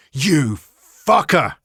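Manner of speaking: angry snarl through gritted teeth. A narrator's reading. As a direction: spit it out through clenched teeth. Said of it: "Adrian said through gritted teeth".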